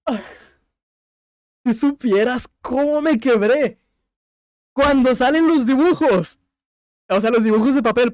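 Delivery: high, tearful, cracking voice; voice breaking with tears. Real, not acted. Podcaster recalling the game's ending, voice breaking with tears. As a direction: near tears, voice catching as he remembers the ending. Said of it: "Estoy llorando".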